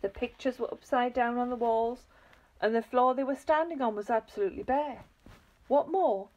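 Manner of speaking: ordinary delivery, nothing marked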